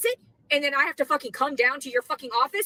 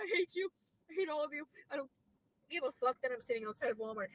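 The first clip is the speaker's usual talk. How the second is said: drained hatred; 12 dB quieter, faster than usual. Real, not acted. After the outburst, drained bitterness.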